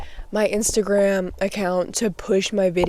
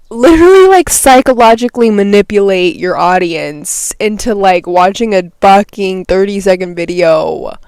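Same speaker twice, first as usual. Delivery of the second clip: heated rant; 8 dB louder than usual. Real, not acted. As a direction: rant at the manipulation, voice raised. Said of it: "I'm getting heated".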